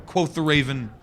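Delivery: ordinary talk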